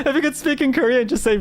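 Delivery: high voice bubbling with laughter; laughing. Real, not acted. Streamer teases about being called [name], laughing. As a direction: talk through the giggles.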